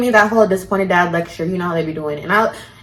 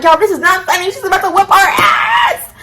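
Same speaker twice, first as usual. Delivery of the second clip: panicked plea; 9 semitones higher than usual. Retold or imitated. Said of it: "I'm like".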